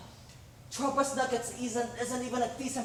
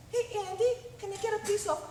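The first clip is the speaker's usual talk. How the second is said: high squeaky chipmunk puppet voice, pitched way up; 9 semitones higher than usual. Retold or imitated. Actor mimicking a puppeteer's chipmunk voice to his agent, high and squeaky. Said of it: "doing a chipmunk voice".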